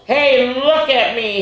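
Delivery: put-on high, slow, playful child voice; excited kid voice. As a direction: call out gleefully like a little pup. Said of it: "he laughs".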